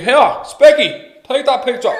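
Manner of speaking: high, loud, slow mock shout; dad shouting at the security guard. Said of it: "he's shouting at the security fella".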